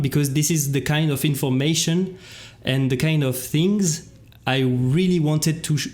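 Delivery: usual speaking voice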